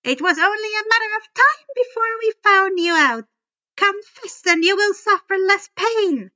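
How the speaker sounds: high, loud, fast threat; furious monster teacher; menacing threat. A narrator's reading. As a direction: demand his confession. Fury threatening the boy.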